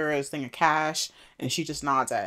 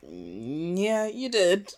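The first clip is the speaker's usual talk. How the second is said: knowing voice; 4 semitones higher, much slower than usual. Retold or imitated. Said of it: "Whisper was like".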